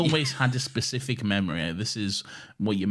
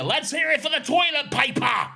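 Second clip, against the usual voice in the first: high, loud shout slipping into pirate; yelled American accent; 6 dB louder than usual. Shown as a demonstration. School Grease performer calling the line out, botched American yell. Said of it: "I was supposed to yell that out".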